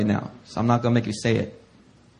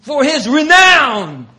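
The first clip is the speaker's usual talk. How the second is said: much higher, loud, last line slow; Piper preaching shout; 14 semitones higher, 8 dB louder than usual. Retold or imitated. Preacher imitating Piper exhorting crowds, loud shout. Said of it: "John Piper will always preach".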